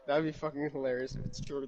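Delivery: usual speaking voice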